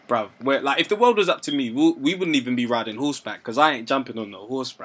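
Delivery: 1 lightly voiced sceptic. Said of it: "Someone might have been like".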